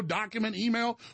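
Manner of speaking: ordinary delivery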